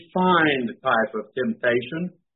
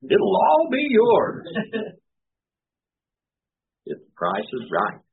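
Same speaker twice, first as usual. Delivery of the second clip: game show host; 5 semitones higher than usual. Retold or imitated. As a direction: sell it like a TV prize host. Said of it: "Doesn't that sound like a game show host?".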